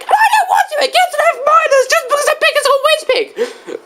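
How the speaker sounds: very high, quick cartoon rant; high-pitched indignant tirade; angry Diddy Kong. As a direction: gabble the complaint in a squeaky-high voice. Diddy rants about Whiz Pig.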